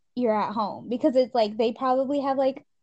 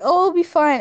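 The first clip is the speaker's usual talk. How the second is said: careless mock reassurance; 6 semitones higher, 6 dB louder than usual. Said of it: "And you're just like".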